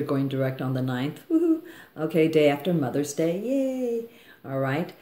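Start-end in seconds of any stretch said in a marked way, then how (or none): 1.3-1.6 high excited woohoo; cheering. Real, not acted. Cheering Jupiter going direct. 3.4-4.0 higher excited yay; a happy little cheer. Real, not acted. Cheering the date.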